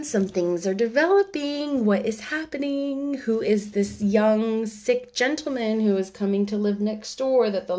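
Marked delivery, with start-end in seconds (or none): none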